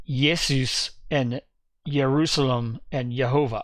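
"Jesus" as "Yesus", "Jerusalem" as "Yerusalem", "Jehovah" as "Yehovah"; German Y sound. Shown as a demonstration. Preacher showing the German Y sound in "Jesus". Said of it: "the Germans still pronounced it with the Y sound".

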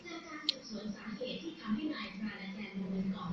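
reads the report in a hushed whisper; whispered reading. Acted. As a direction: whisper it like a secret case file.

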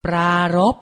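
ปรารภ said carefully as pra-rop; careful teaching read of ปรารภ; pronunciation model. Shown as a demonstration. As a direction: model ปรารภ with a long first syllable. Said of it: "ออกเสียงพร้อมๆพี่ไทยให้ถูกต้อง".

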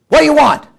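loud, high-pitched bark at a stranger; storyteller's irate, startled homeowner; angry challenge. Acted. Sleepy homeowner with shotgun to late-night knocker, angry shout.

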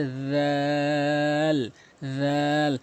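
"রাল" as thin voiced th with long vowel; light th. Shown as a demonstration. Teacher contrasting, long thin "রাল". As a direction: stretch "রাল" flat on one note. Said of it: "আর এটি হচ্ছে".

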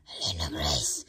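whispered name, almost no voice. Real, not acted. Mother whispers her daughter's name.

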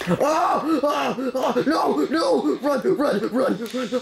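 high, frantic cries to run; panicked fleeing. Real, not acted. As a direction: panic as the mobs chase you.

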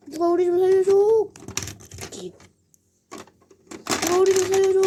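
high, loud panicked plea; cry for help. Acted. Kids hiding from dad, screaming for help. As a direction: scream for someone to save you.

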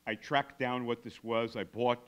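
ordinary talk, nothing marked

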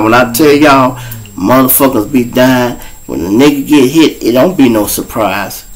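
usual speaking voice

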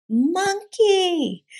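very high, startled yell; squealed cartoon outburst. A narrator's reading. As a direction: cry it out way up high. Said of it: "yelled Scapito".

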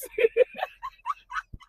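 real high squealing laugh. Real, not acted. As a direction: burst out in helpless high laughter. Laughs at retold denial.

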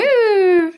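high, loud "woo"; celebratory whoop. Real, not acted. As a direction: cheer with a drawn-out woo. Cheering the last sponsor named.

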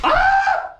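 high, loud pretend fright scream; dad pretending the monster drawing scares him; mock scream. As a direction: fake a scared yelp at the drawing. Child reveals scary drawing.

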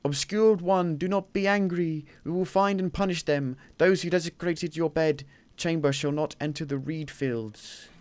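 high solemn plea to a god; solemn priest. Pretend. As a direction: soothe an angry deity. Pleading to god in game.

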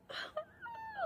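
tiny high-pitched squeal; quiet high squeal at something cute; adoring squeal. Real, not acted. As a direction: let out a soft, very high squeak of delight. Overcome by the cute teacup.

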